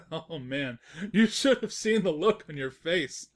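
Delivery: mocking laugh through the words; laughing at her. Narrator laughs at Twilight's face, teasing and amused.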